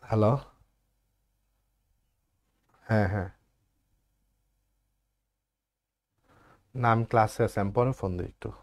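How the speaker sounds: low murmur into the phone mid-class; whispering on the phone. Real, not acted. Teacher takes a phone call mid-class.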